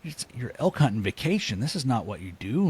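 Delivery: high-pitched exasperated telling-off; self-scolding. Retold or imitated. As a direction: scold yourself in mock anger, pitch jumping up. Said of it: "I was so mad at myself".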